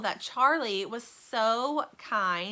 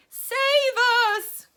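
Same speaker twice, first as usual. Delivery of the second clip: put-on high, loud old woman's cry for help; frightened cry; 12 dB louder than usual. A narrator's reading. Grandma Josephine crying for help as the roof crashes, high frightened cry. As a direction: cry out, voice fading away. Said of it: "cried Grandma Josephine".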